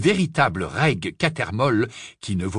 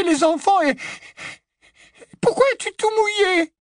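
high, loud, fast-spilling frightened woman's voice; a trembling wife pouring out her relief and fear; anxious wife; 4 dB louder than usual. Acted. Frightened wife urging her husband to flee, anxious and high. Mrs Cattermole voiced by reader.

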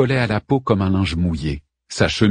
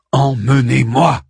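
louder, slow, insistent; insistent demand; 5 semitones higher, 5 dB louder, slower than usual. A narrator's reading. Arman repeating his demand to Ariel, insistent. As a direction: hammer out the repeated order. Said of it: "répéta Arman".

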